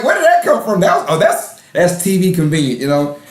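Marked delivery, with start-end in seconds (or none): 0.0-0.8 high, amazed exclamation; mock surprise at a twist; surprised. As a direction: blurt it out in disbelief. Retelling own surprised reaction.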